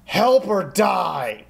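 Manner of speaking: high, loud, drawn-out yell; in-character intimidating scream; screaming battle threat. Acted. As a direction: scream it at the top of your lungs. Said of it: "screams at the top of the, her lungs".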